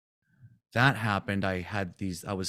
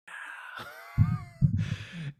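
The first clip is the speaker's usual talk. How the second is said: laughing shout; 3 dB quieter than usual. Real, not acted. Reacting to his awakening story.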